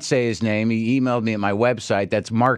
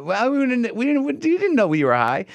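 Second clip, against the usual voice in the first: much higher, stammering mock reply; imitating flustered friends; 12 semitones higher than usual. Imitating party guests answering a confession of being high, flustered. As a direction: stumble over it in a high put-on voice. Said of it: "And they go".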